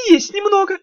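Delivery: high, wheedling little imp voice; sly, sugary demon lecturing; голос Тухламона. Acted. Тухламон отвечает Даф. (вкрадчивый писклявый голосок беса)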